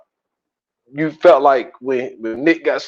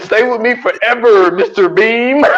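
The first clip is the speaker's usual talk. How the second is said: mock clingy; 8 semitones higher than usual. Mock tender joke.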